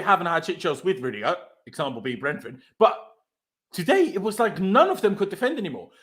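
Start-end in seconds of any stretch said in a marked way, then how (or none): none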